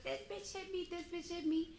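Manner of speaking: high, softened mock wail; high-pitched retold accusation; imitating someone wilding out. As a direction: mimic her outcry up high without the volume. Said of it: "she just started wilding out".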